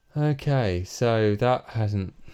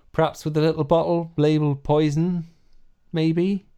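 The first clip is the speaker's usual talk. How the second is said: put-on West Country accent; 5 semitones higher than usual. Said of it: "my West Country accent".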